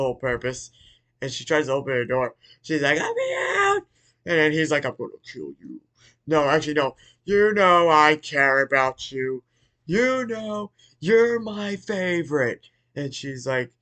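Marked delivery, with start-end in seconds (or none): 3.0-3.8 very high, squealed plea; mock panicked squeal. Daughter trapped at the door in retelling, mock squeal. 4.8-5.8 low, soft menacing father; mock villain threat. Father threatening his daughter in retelling, low menace. 7.2-12.7 slow, drawn-out and climbing higher; hammed-up father voice. Father telling daughter she is his favorite, hammed-up. Retold father's mock declaration.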